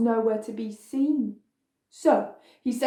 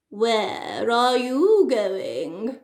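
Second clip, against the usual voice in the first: wolf voice; much slower than usual. A narrator's reading. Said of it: "the wolf said".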